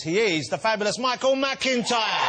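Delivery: big showbiz host announcing guests; booming chat show intro; host introduction. Acted. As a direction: sell each guest to the crowd.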